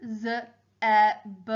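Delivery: "z, e, b" as separate phonics sounds; sounding out letters. Shown as a demonstration. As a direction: spell "z, e, b" by sound. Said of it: "help me spell out the word".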